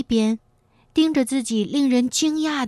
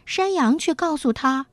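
usual speaking voice

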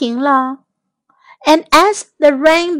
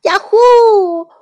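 high, loud, drawn-out whoop; joyful high holler; excited shout; 8 semitones higher, 6 dB louder than usual. A narrator's reading. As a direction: cheer it out at full voice. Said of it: "杰克也喊道".

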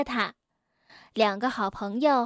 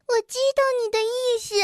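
broken-tooth voice; 9 semitones higher, faster than usual. A narrator's reading.

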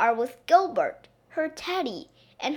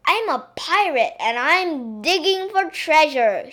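announcing; 4 semitones higher, 5 dB louder than usual. Acted. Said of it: "she announced".

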